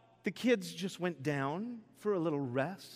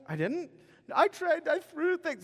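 high defensive protest; 12 semitones higher, 3 dB louder than usual. Retold or imitated. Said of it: "I was like".

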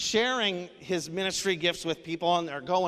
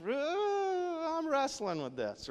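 voice breaking; 11 semitones higher, 4 dB quieter, slower than usual. Retold or imitated. Retelling his own struggle, voice breaking.

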